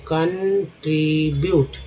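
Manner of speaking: Contribute drawn out in three parts; teacher's slow chunked contribute; sounding out. Shown as a demonstration. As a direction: split contribute slowly into कं, tree, ब्यूट. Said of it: "टी को tree बोलते हैं".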